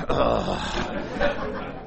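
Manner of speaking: breathy unvoiced groan at a bad joke; mock audience groan, almost whispered. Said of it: "some of you are going".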